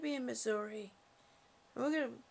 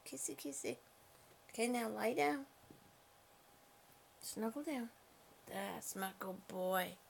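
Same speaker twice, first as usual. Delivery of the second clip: soothing the cat; 4 semitones lower, 4 dB quieter than usual. Real, not acted. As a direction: coax the cat to snuggle down gently. Settling the cat down.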